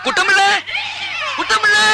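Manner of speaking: urgent call. Acted. Father shouting for the servant to come help. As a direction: yell the name across the house.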